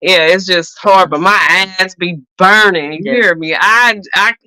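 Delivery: usual speaking voice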